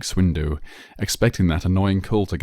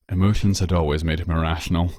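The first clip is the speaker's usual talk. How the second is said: plain reading voice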